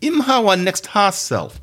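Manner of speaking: measured Jamaican patois quote, high on him. Shown as a demonstration. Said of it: "all these are English words".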